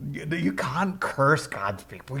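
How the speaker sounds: normal talking voice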